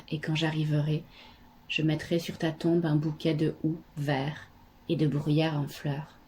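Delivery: subdued, even poetry reading with long pauses; poem recitation. Acted. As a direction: recite softly and low, sinking toward the end. Reads a poem aloud. (lecture de poème sobre et recueillie, à voix basse)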